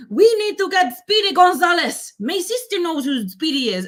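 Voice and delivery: high, loud cartoon quote; cartoon character. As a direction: act out an urgent cartoon mouse. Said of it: "he goes".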